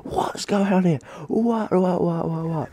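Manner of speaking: high-pitched mock scream, kept soft in volume. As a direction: imitate a teacher shrieking in disbelief, without real loudness. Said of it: "he screamed and screamed and screamed".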